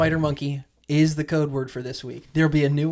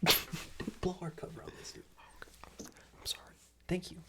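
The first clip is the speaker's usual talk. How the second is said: hushed secret whisper; 3 semitones higher, 14 dB quieter than usual. Real, not acted. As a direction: whisper it so nobody hears. Secret aside to co-host.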